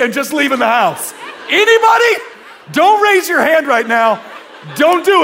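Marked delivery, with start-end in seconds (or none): 1.5-5.2 high, loud shout to the room; shouted call-out. Real, not acted. Preacher shouting to the congregation, loud and high. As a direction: call out to the whole crowd.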